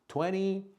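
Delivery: "Twenty" said "twenny", high and light; American twenty. Shown as a demonstration. A teacher reads a year with the American "twenny". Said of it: "Twenty or twenty is American".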